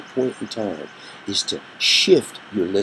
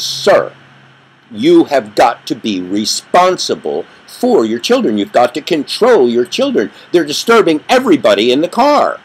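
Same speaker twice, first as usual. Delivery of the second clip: loud, firm reprimand, louder at the end; angry scolding; 5 semitones higher, 7 dB louder than usual. Retold or imitated. Covey confronting the inattentive father, loud and indignant. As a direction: tell him off sharply, voice rising.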